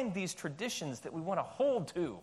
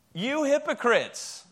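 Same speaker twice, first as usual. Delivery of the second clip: emphatic call-out; 5 semitones higher, 5 dB louder, slower than usual. Shown as a demonstration. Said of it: "Say it really loud".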